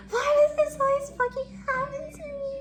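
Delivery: very high, loud mock wail; hammed-up sobbing scream. Woman re-enacting her meltdown on the street, sobbing scream. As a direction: overdramatic wailing, replaying a meltdown. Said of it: "I was, like, sobbing".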